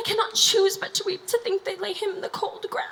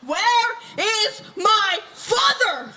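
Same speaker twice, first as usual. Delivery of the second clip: furious demand; 3 semitones higher, 3 dB louder, much slower than usual. Acted. Laertes demanding his father from the king, furious. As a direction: drag out each word in fury.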